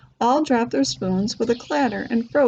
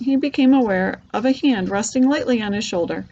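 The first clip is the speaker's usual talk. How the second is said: usual speaking voice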